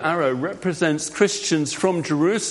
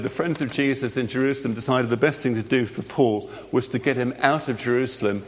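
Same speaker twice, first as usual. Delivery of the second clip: ordinary delivery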